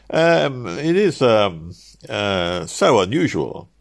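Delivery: hemming, high-starting hesitation; flustered clerk. Acted. Cook's clerk balking at an unusual request, flustered. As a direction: grope awkwardly for an excuse.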